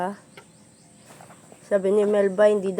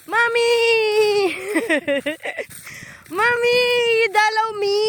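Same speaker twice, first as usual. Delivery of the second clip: put-on much higher and louder, a whining baby calf calling Mommy; high crying calf voice; 12 semitones higher, 7 dB louder than usual. Owner voicing her calf calling to her, crying high and loud. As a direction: wail it like a little calf crying for its mother. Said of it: "Sabi niya".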